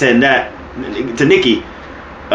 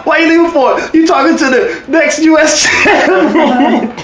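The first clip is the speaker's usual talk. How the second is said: much higher, incredulous mock shout; Heath Slater; 12 semitones higher, slower than usual. Co-host imitating the wrestler calling after someone leaving, laughing high shout. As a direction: squeal the boast, cracking up at the end. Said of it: "He's like".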